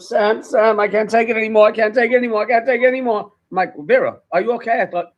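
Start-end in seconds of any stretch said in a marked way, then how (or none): 0.0-3.3 high, loud mock hysterics; retold distraught crying. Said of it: "She calls me up crying her eyes out". 3.8-4.8 raised, loud worried question; retold alarmed concern. Retold reply to crying caller.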